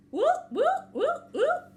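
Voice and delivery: high, loud rising whoops; vocal siren sound effect; imitated alarm siren. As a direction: whoop like an alarm, sweeping up each time.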